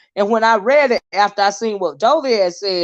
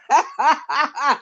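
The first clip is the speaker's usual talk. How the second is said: brief real laugh, slightly higher; 4 semitones higher than usual. Real, not acted. Host laughing as Bless Watkins goes live, amused laugh. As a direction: let a laugh slip out at the timing.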